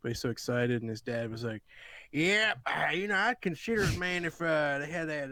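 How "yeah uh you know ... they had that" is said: a mock Southern dad mulling it over. Friend imitates his brother-in-law in a Southern accent. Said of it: "I'm giving a Southern voice".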